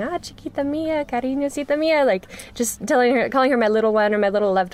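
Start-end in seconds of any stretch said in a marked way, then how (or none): 0.0-2.0 sweet-talking a scared rescue dog, higher and cooing; tender pet talk. Retold or imitated. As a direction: coo to a frightened dog like a baby. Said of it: "I was talking to her and just saying".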